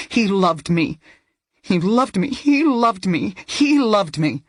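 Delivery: insisting through grief. A narrator's reading. Daughter grieving her father, repeating it desperately.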